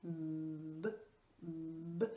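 "B" held long, a buzzing voiced b between closed lips. Shown as a demonstration. Speech therapist to parent, a long humming b. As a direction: hum the "b" through closed lips so the lips vibrate. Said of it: "faire exprès d'allonger le son".

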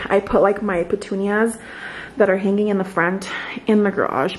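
ordinary talk

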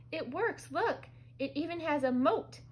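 high-pitched delighted exclamation; excited. A narrator's reading. Brother sees his castle appear, excited. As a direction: show it off eagerly.

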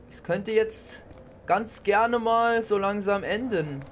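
usual speaking voice

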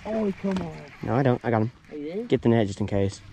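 normal talking voice

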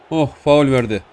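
ordinary delivery

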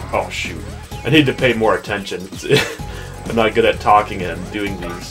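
ordinary talk

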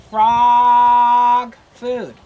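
FROG stretched out long and loud, then food; drawn-out, emphatic FROG; word sounded out. Shown as a demonstration. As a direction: sound out "frog" slowly and loudly for early readers. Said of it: "This says".

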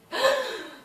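high, sudden gasp; sharp gasp. Real, not acted. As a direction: suck in a startled breath. Reacting to game.

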